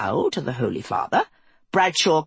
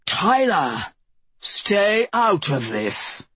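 slow cold order; 3 semitones higher, much slower than usual. Acted. Holy Father silences Tyler.

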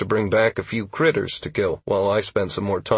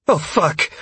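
high-pitched shocked gasp; gasped dismay; 9 semitones higher than usual. A narrator's reading. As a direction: gasp the curse in alarm. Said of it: "I heard Sierra gasp".

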